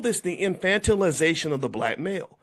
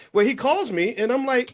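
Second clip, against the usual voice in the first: usual speaking voice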